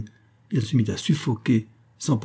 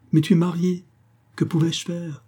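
higher, urgent plea; pleading; 8 semitones higher than usual. A narrator's reading. Lover pleading with his married mistress, higher and urgent. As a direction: press her to understand, rising. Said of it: "Il balbutia".

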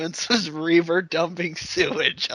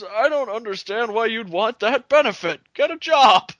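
high, rising to a shout; mocking senile politician; 7 semitones higher, 5 dB louder than usual. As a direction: ham up the clueless old politician.

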